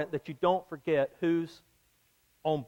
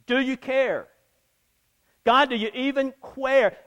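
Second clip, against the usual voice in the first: higher, louder "Do you care? God, do you even care?"; desperate plea to God; 6 semitones higher, 4 dB louder than usual. Retold or imitated. Believer crying out to God in a storm, raised and desperate. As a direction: cry it up to heaven in anguish.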